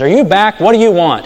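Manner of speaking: annoyed landlady snapping at the returning beggar; irritated snap. Retold or imitated. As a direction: bark it at the unwanted caller. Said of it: "the lady says".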